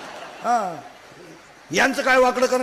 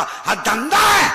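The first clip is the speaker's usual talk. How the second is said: slow, loud and higher, hammering each word; furious shout; 5 semitones higher, 6 dB louder, slower than usual. Real, not acted. Preacher denouncing the boys' racket to the crowd, slow furious shout. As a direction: bring the point down like a verdict.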